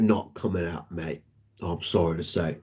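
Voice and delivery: a bad, mumbled British accent; mock British, quiet and half-hearted; lightly done British accent; horrible British accent. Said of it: "That's a horrible British accent".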